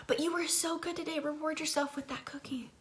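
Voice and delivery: high, soft whispering devil; tempting devil. Retold or imitated. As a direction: coax like a sly tempter. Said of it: "the devil will pop up and he'll say".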